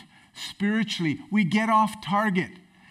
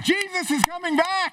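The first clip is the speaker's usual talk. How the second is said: much higher, jubilant declaration; excited proclamation; 11 semitones higher than usual. Shown as a demonstration. Pastor retrying the line for the church, excited. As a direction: proclaim it with joy, lifted up. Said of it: "let me try that again".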